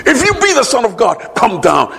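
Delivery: jeering mocker. Mockers at the cross voiced.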